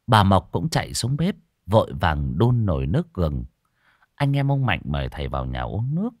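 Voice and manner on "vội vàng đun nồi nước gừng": ordinary delivery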